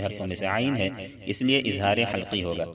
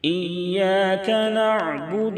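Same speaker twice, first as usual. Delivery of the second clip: slow, high, melodic tajweed chant; slow melodic Quran recitation; 9 semitones higher, 4 dB louder, much slower than usual. Shown as a demonstration. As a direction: draw each vowel out in a sung recitation. Recites verses.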